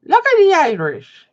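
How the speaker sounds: high, loud, botched Scottish accent; failed Scottish accent. Shown as a demonstration. Streamer attempting Scottish for chat, failed Scottish accent. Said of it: "That's not even a Scottish accent".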